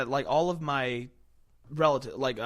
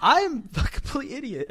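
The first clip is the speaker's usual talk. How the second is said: laughing; 11 semitones higher, 8 dB louder than usual. Real, not acted. Self-mocking, laughing.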